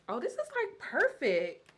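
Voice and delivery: high-pitched, delighted exclamation; excited squeal of approval in words; real excitement. Real, not acted. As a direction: burst out high, thrilled with the find. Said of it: "if you can hear the excitement in my voice".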